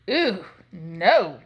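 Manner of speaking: loud, swooping "ooh, no"; disgusted cry. A narrator's reading. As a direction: cry out at a sour taste. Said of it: "Leola cried as she puckered her lips".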